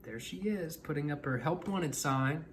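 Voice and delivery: ordinary delivery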